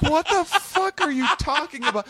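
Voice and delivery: shocked disbelief. Retold or imitated. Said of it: "I'm like".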